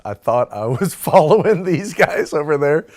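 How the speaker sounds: high, loud, laughing through the words. Real, not acted. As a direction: crack up at your own mistake. Laughing at his own mistake.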